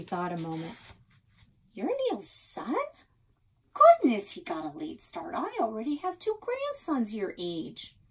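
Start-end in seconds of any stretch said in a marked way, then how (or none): 1.7-8.0 put-on higher, startled old woman; surprised old Nan. A narrator's reading. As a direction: gasp at the news, then chatter on. Nan surprised at meeting Alex.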